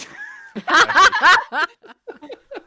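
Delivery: big rising burst of laughter; loud high laugh. Real, not acted. Laughs after teasing joke.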